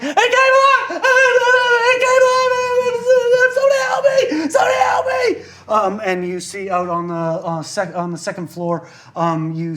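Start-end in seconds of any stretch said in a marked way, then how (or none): 0.0-5.4 high, loud screams for help; terrified scream. Acted. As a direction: shriek for help in terror.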